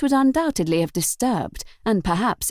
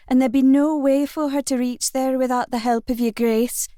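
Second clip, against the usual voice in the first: put-on young maid, pitched up in broad Scots; a maid begging a favour in broad Scots; broad Scots accent; 5 semitones higher than usual. A narrator's reading. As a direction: ask the Duke politely in broad Scots. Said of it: "she said in a broad Scots accent".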